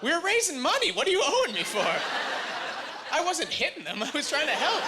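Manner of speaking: high, laughing protest; defensive protest. Real, not acted. Comic protests to the crowd in a high laughing defensive voice. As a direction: plead your innocence, laughing.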